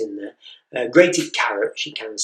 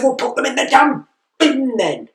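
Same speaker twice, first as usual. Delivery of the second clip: much higher, louder, fed-up outburst; losing temper; 12 semitones higher, 7 dB louder than usual. Retold or imitated. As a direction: blow up at the child.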